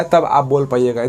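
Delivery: ordinary delivery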